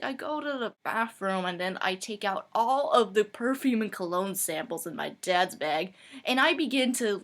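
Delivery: ordinary delivery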